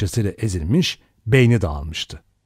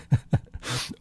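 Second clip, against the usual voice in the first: short acted laugh; burst of laughter; 7 dB quieter than usual. A narrator's reading. Vera scoffs at the idea of cold, laughing. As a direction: break into a derisive laugh. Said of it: "Vera kahkahayı bastı".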